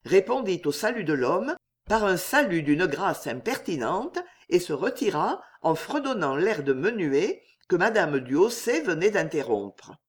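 ordinary delivery, nothing marked

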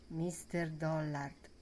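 mormorato piano e lento; mormorio sommesso. A narrator's reading. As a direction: sussurra il nome con riguardo. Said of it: "mormorarono sommesse". (le ragazze mormorano il nome, piano e lente)

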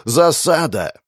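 sudden alarmed shout; enemy spotted shout. A narrator's reading. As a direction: yell the warning high and sharp. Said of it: "клон рыцаря закричал".

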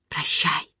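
soft, low farewell under the breath; whispered farewell. A narrator's reading. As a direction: murmur it to yourself as a private goodbye. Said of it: "прошептала я про себя".

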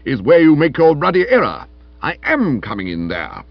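loud, forceful crisp rebuttal; crisp retort. A narrator's reading. Stilton overruling Florence at the door, loudly and crisply. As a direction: insist crisply on coming in. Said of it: "said Stilton crisply".

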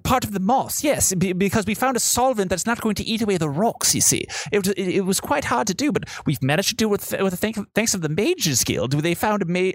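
high, rambling guide voice; eager tour guide. Acted. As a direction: explain proudly, building to a pleased "ha ha".

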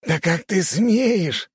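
high, slow, drawn-out outrage; indignant outburst. A narrator's reading. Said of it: "вновь взорвался огневик".

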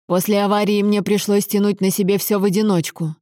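usual speaking voice

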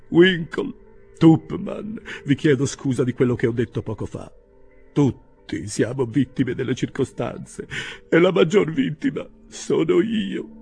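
high, loud, theatrical lament; plaintive self-justification. A narrator's reading. Pickwick apologises to his friends, in a loud plaintive lament. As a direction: plead as the greatest victim. Pickwick pleads as wronged victim.

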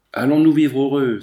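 loud, elated outburst; joyful exclamation. A narrator's reading. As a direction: cry it out together as a happy young couple. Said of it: "s'écrièrent à la fois Lucien et Gabriel". (s'exclamer avec joie, d'une voix forte)

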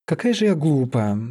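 usual speaking voice

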